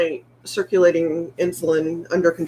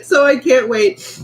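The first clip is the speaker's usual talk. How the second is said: laughing; 7 semitones higher, slower than usual. Real, not acted. Laughing about turning forty.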